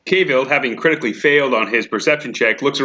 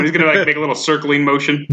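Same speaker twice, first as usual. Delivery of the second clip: ordinary talk, nothing marked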